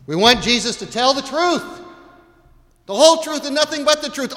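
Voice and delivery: fiery preacher's cry; full-force preaching. Acted. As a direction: preach it out, rising to a fast loud peak.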